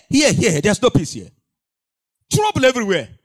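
loud fiery preaching shout; impassioned pulpit preaching. Acted. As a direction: warn the congregation at full voice. Preaching at full force.

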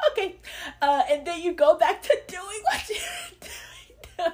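laughing and half crying at it. Real, not acted. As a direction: laugh it out loudly in disbelief. Mocking relapse after fake repentance.

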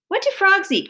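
put-on high, loud boy's shout; calling out. A narrator's reading. Son calling from basement stairs, loud. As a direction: call up from downstairs. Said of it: "called Benji from the basement stairs".

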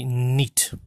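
"neat" as the dialect word for night, long ee; dialect pronunciation. Shown as a demonstration. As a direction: say "neat" for night, North East English style. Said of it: "in my dialect, we say".

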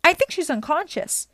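high, loud report; yelled from above. A narrator's reading. Grover reports on Medusa from the air, yelling. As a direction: call the news down to friends. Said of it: "Grover yelled somewhere above us".

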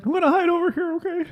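high silly pet voice; dog's inner voice. Pretend. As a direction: speak for the dog, high. Voicing the hiding dog.

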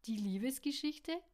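coy, teasing lift in the voice. A narrator's reading. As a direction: tease him with a rising lilt. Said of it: "meinte sie neckisch".

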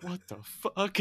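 high-pitched mock bewilderment; M P H baffled. Tripping star reacting to Ron before driving away, high bafflement. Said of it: "M P H is like".

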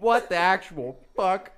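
loud, slow, high-pitched disbelief; retold furious yell; mock outraged dad. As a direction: spit each word out in outrage.

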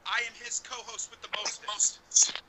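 ordinary delivery

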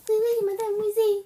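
loud mock-French reply. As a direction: play at being French. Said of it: "I guess you're French now".

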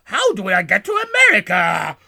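loud put-on alien cry; hammed-up shouted question; Nuki. Stranded alien wonders how to reach brother, mock shout. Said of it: "And Nuki goes".

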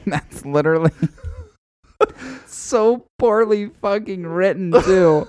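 laughing through the words; high, giggling disbelief. Real, not acted. Laughing at bad writing.

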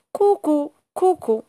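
high "cucù, cucù" imitating the bird's call; copying the cuckoo, high and sing-song; dog tries cuckoo. Dog copying the cuckoo's call, high bird voice.

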